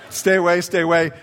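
hammed-up nervous warning; keep-away plea. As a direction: push him back with your voice. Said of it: "I'm like, you know".

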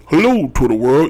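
normal talking voice

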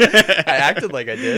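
laughing through the confession, slow. Real, not acted. Owning up to faking it, laughing slowly. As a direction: admit it while cracking up.

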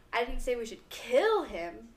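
slow delivery with "kill" stressed and rising. Shown as a demonstration. Friend demos the example sentence with stress on "kill". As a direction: drag it out and hit "kill" hard. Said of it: "if you emphasize on one word".

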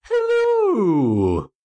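put-on high falsetto old nanny hello; sing-song granny greeting; Mrs. Doubtfire voice. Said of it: "I don't know why I".

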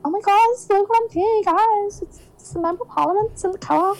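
high-pitched, mock-thrilled gushing; retold starstruck excitement. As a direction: gush like an excited onlooker spotting someone important. Retold thrill at spotting local MP.

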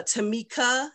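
Tamika with an added K; wrong name, Tamika ending in "kuh"; mispronounced name. Shown as a demonstration. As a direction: say Tamika as tuh-MEE-kuh. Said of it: "my name has been mispronounced".

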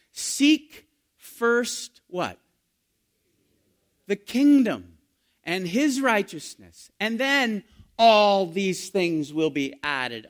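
loud, high, slow scripture; booming sermon quote; preaching at full force. Acted. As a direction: proclaim it from the pulpit.

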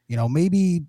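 ordinary delivery

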